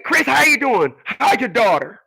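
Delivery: put-on high, pinched old lady voice; scrunched voice. Missus Crawley asking Chris about his daughter, scrunched voice. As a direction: squeeze the voice up high like her. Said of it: "she had a very scrunch voice".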